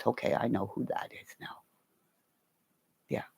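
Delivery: quick whisper. Real, not acted. Aside after reading a name.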